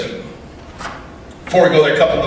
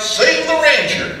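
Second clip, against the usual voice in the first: higher, slow rallying cry; slower than usual. Acted. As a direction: call it out like a rally slogan. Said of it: "why, I'd probably cry out".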